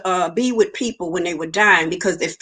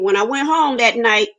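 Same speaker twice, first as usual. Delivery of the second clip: ordinary delivery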